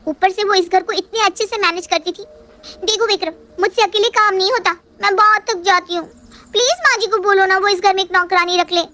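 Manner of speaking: sulky daughter-in-law pleading her case; Riya's voice. Acted. Riya pleads with husband.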